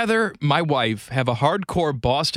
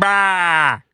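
put-on Boston accent; 4 dB louder than usual. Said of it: "Boston accent".

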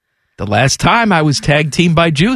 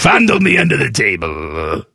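Larry King; 6 semitones lower than usual. Acted. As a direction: draw it out in a put-on voice. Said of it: "when Larry King turned into Jiminy Glick".

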